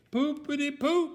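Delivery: high comic cry; high, mock-distressed cry; cried out. A narrator's reading. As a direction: squeal it like a squashed character. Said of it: "cried Mister Bump".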